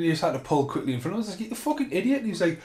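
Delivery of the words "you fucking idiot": high-pitched exasperated jab; pitched-up mock fury; retold angry insult. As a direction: fling the insult at the other driver. Retold road rage in car.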